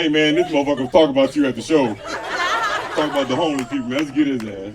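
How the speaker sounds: loud, laughing street voice; mock angry homie; homeless homie. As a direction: rile up the crew. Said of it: "go tell one of your homies, like".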